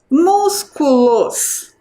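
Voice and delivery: "Músculos" very slow, MÚS strongest; stress on first syllable. Shown as a demonstration. Teacher asks pupils for the strongest syllable, MÚS in "Músculos" stressed and drawn out. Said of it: "Qual é a sílaba mais forte?".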